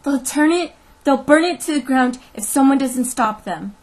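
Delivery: high, loud, frantic warning; gasping alarm. A narrator's reading. Teacher begs the sheriff to stop the mob, in gasping alarm. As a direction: plead urgently for help. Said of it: "she said, gasping for breath".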